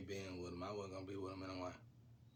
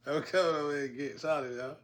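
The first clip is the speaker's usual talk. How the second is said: loud, high announcement to the crowd; calling out; 6 semitones higher, 11 dB louder than usual. Retold or imitated. Announcing to the men in line that he's taking her, loud call.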